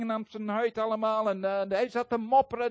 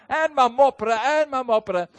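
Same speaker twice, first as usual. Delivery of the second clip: much louder, punching each phrase; emphatic loud narration; 4 semitones higher, 9 dB louder than usual. A narrator's reading. Preacher telling the congregation how the pastor grumbled, loud and emphatic. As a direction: hammer home how he kept grumbling.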